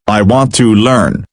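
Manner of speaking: plain reading voice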